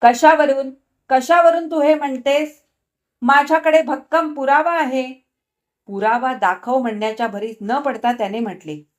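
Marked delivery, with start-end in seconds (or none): 0.0-5.3 irritated. A narrator's reading. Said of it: "त्याने चिडून म्हटले".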